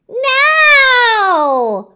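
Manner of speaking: high, loud panicked no; frightened cry. Acted. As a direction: shriek it in terror. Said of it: "cried the witch".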